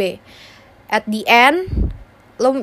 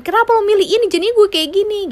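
high, louder accusing complaint; blaming whine; 10 semitones higher, 3 dB louder, faster than usual. Retold or imitated. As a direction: whine the blame at someone. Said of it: "kayak jadi".

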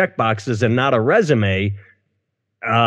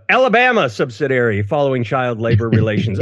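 usual speaking voice

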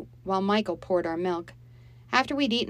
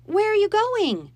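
much higher and louder, a mother calling after him in distress; quavering call; 9 dB louder than usual. Acted. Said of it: "her voice quavering".

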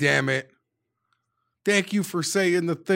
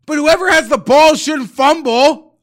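much higher and louder furious yelling; furious shout; 13 semitones higher, 10 dB louder than usual. Real, not acted. Fan yelling about the fumbled half yard, furious shout. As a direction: scream the obvious at the fumbler.

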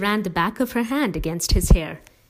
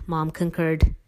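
ordinary delivery

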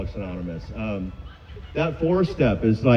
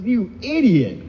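mocking himself; 9 semitones higher, slower than usual. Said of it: "just laughed at myself".

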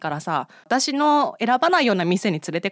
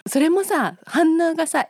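ordinary talk, nothing marked

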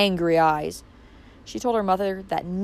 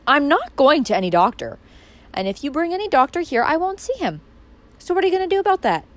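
defiant; 7 semitones higher, 3 dB louder than usual. Acted. Valancy defies her family.

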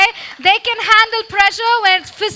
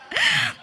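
sudden high laugh; a real laugh that jumps up in pitch; high burst of laughter; 4 dB quieter than usual. Real, not acted. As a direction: break into a high giggle. Laughs at her own example.

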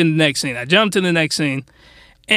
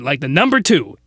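ordinary talk

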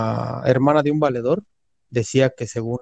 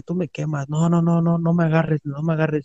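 mock agitated protest, pitched up; hermano alocado imitado; 4 semitones higher than usual. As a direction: imitate a possessed man refusing a grip. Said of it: "se empezó a alocar otra vez". (protesta agitada imitada, en tono agudo)

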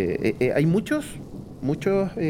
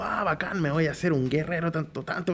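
higher-pitched imitation of keen players; mock eager player; 3 semitones higher than usual. Players hearing of a D&D game, mock enthusiasm. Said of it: "si tú le dices".

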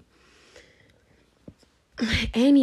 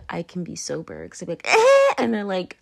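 ordinary talk

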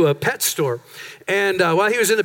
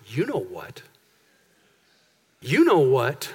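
sinister devil voice; 9 semitones lower, slower than usual. Retold or imitated. Devil whispering accusations to the listener, low and menacing.

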